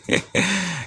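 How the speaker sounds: short high chuckle; soft, high-pitched giggle before the reveal. Real, not acted.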